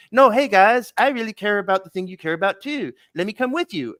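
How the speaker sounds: high, wheedling Cartman imitation; sneaky Cartman. Cartman begging to tag along with the guys, sneakily wheedling. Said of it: "Cartman is a sneaky bastard. He's like".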